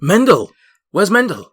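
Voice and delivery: loud, high cry of alarm. Acted. Dwarf learns of Mendel's part and asks after him, alarmed. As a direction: call out urgently, pitch leaping up. Dwarf reacts to bad news.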